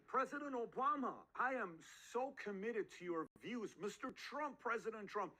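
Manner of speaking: high, hammed-up flattery; mock gushing appeal, pitched high; fawning guest-seeker. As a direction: court a famous guest with exaggerated devotion. Acting fawning beginner courting presidents.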